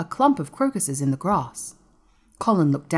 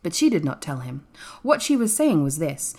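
ordinary delivery, nothing marked

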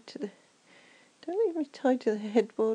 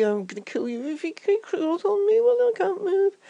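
high, loud panicky wail; half-laughing terrified threat; panicked cry; 6 semitones higher, 6 dB louder than usual. Acted. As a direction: cry out as the spider nears. Tied up, spider approaching.

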